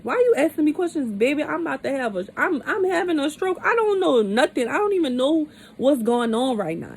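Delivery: loud, high-pitched retold outburst; exasperated protest. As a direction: protest like you have no time for questions. Acted protest at hospital questions.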